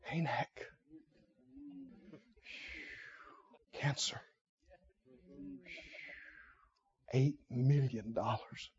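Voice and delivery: hushed fearful whisper of a dreaded name. Retold or imitated. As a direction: breathe the words like a dread secret. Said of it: "They whispered it".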